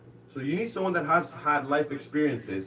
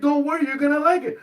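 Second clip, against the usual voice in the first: high, loud imitation of her; pitched-up retold reassurance; insistent woman; 12 semitones higher, 6 dB louder than usual. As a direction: mimic her brushing off the protest. Girl insists in retold scene.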